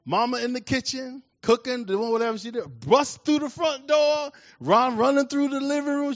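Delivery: high, drawn-out, breakneck-drama preaching; high-pitched, full-voiced dramatic retelling; fired-up preacher storytelling. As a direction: tell it like a preacher building to the climax.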